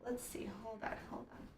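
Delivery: hushed search under breath; quietly to herself; whispering. Real, not acted. Whispering while searching for stamp.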